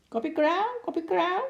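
very high parrot squawk; screeching parrot. A narrator's reading. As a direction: screech it like a parrot, rising at the end. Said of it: "schreeuwde Lorre". (heel hoog krijsende papegaai)

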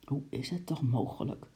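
soft, dejected mumble; defeated mumble. Acted. Lady mumbles in dismay at her sinking coat, soft. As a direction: mumble it, beaten. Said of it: "mompelde mevrouw Kwant verslagen".

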